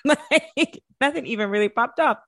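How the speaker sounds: high, laughing brush-off; laughing dismissal. Retold or imitated. Cousin laughing at her, high and amused.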